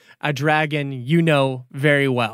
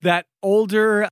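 normal talking voice

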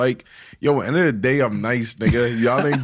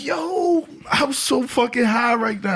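high excited voice; 9 semitones higher than usual. Retold or imitated. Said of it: "I'm like".

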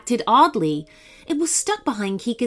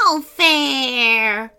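shrill insect cry of protest; wasp cry; 3 semitones higher, 4 dB louder than usual. Acted. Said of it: "the horrible wasp cried".

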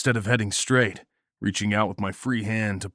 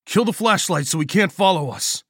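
high, raised-voice command; tense, hurried-sounding shout to companions; urgent shouted order; 10 semitones higher, 4 dB louder than usual. A narrator's reading. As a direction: call it out over the wind. Shouted order while fleeing into blizzard.

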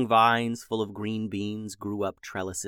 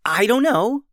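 put-on high, loud flustered girl; flustered Beatrice; 13 semitones higher, 9 dB louder than usual. A narrator's reading. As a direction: blurt it out, caught off guard. Beatrice voiced higher.

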